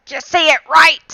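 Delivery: high-pitched mock scolding; stickler parent. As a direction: imitate a parent correcting pronunciation. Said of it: "They were like".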